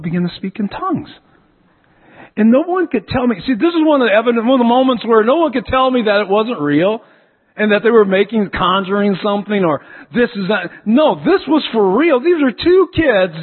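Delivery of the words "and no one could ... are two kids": high, loud preaching at full force. Acted. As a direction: build to a testimony climax, pitched up. Preaching climax, raised and loud.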